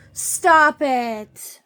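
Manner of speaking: loud sharp stop; scolding. Real, not acted. Owner loudly scolding her scuffling dogs. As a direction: bark the order.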